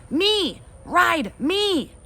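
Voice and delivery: put-on high, loud squeal of a toddler; demanding toddler. Toddler Fudge demanding his turn, high squeal. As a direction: claim it like a little brat.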